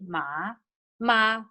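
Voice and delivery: "ma" held on a level pitch; level first tone. Shown as a demonstration. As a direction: sustain "ma" evenly as a tone demo. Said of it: "first tone is ma".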